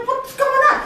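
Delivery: very high, bewildered query; alarmed question. Retold or imitated. Employee called in by boss, high alarmed question. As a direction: ask in high-pitched confusion.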